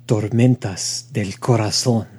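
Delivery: put-on slow, sultry Spanish-accented voice; sexy voice. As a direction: purr it like a Latin soap seducer. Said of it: "loved hearing that voice out of you".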